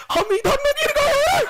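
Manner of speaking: very high, slow squeaky little voice calling up; voice from below. Acted. Ovule calling up from the pit to the pollen, high and squeaky. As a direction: play a tiny ovule crying out from down below. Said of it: "तले नीचे से आवाज आएगा".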